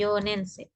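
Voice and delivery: Yonensei said with one single n, not doubled; Yonensei, yo-nen-sei, one short n. Shown as a demonstration. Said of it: "Hati-hati N-nya hilang ya".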